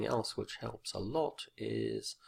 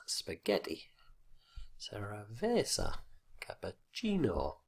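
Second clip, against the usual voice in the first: spaghetti, cerveza, cappuccino leaning on GHET, VE, CHI; second-last syllable stress. Shown as a demonstration. Teacher illustrating fixed stress, second-last syllable stretched in each word. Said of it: "second last syllable which always carries the stress".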